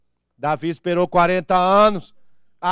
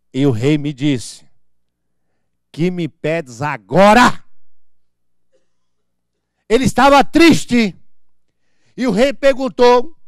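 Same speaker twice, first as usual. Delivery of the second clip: loud sermon reading; 10 dB louder than usual. Acted. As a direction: declaim the king's story to the congregation. Reads Bible story aloud.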